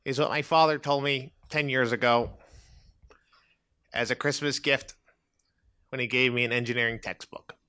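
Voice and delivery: low deadpan reader. Acted. Man reads his memoir piece.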